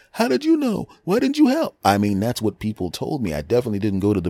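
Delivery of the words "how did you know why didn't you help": very high mocking voice; mocking whine. Teasing the beaten friend in a high mock whine. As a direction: squeak it in mock outrage. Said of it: "wake him up going".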